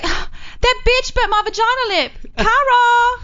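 very high, slow, outraged whine; mock high indignant wail; Phoebe. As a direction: squeal the complaint like a wronged roommate. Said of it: "She's like".

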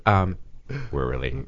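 ordinary talk, nothing marked

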